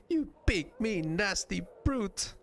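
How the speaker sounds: high little voice trying to be mean; slow, high-pitched mock scolding; sweet character badly faking nastiness; feeble pretend insult. Nenny practises an insult.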